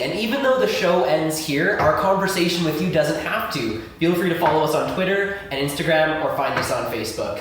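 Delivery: loud upbeat host read; radio host outro. Acted. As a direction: sign off the show cheerfully.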